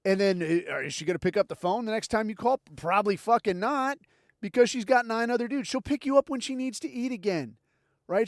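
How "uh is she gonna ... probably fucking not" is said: ordinary talk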